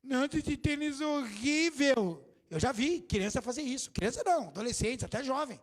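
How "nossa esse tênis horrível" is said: slow, disdainful mock teen complaining; spoiled teen. Preacher mocking a spoiled teen about sneakers, disdainful drawl. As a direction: drag it out like a picky kid. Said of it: "Fica lá".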